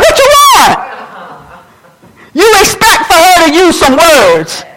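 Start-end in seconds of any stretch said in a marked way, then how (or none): 0.0-0.8 high, exasperated parent's demand; sudden high-pitched fed-up question; exasperated mother. Acted. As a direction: snap back at a wailing baby. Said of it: "You're like".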